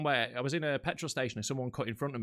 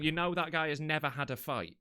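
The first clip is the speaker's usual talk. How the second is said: ordinary delivery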